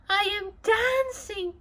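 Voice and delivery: high, delighted exclamation; joyful cry. A narrator's reading. Gerald discovering he can dance, high joyful cry. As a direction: burst out in happy disbelief. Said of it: "Gerald cried".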